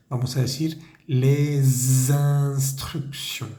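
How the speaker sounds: les instructions with a slow buzzing Z joining les to instructions; slow French teacher demo of les instructions linked by a Z sound; liaison demo. Shown as a demonstration. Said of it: "Vamos a hacer vibrar esa S".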